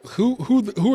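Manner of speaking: sudden high disbelieving cry; can't believe anyone disagrees; incredulous who. Real, not acted. Host mocks poll holdouts, incredulous high cry.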